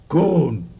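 loud, curt threat, falling at the end; menacing tone. Shown as a demonstration. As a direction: bark the bare surname with menace. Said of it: "say it in that tone of voice".

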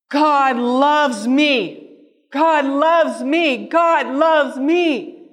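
slow, high, forceful cry of faith; defiant declaration, loud and stretched; loud exclamation. Retold or imitated. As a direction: shout it out against the doubt, drawing out each word. Exclaims under covers against doubt.

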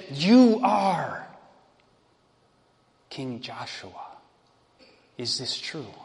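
a hushed, breathless question, fading to near silence; barely able to believe it; stunned disbelief. A narrator's reading. Woman realizing her groom is the king, stunned and hushed. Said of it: "confusion turned to utter surprise".

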